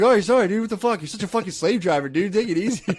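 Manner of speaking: high, sarcastic brush-off; mocking whine. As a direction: sneer back at the boss to take it easy. Workers mock boss back.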